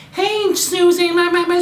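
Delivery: high, chirpy mock girly phone voice; hammed-up carefree girl chatting; Betty on the phone. Betty chats to Susie on her cell, in a high chirpy mock girly voice. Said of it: "she's talking on her cell phone".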